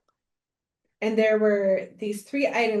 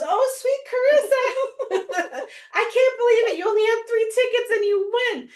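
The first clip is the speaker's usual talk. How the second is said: delighted host voice; 13 semitones higher, 4 dB louder than usual. Retold or imitated. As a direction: gush like the host calling the winner. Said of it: "And she goes".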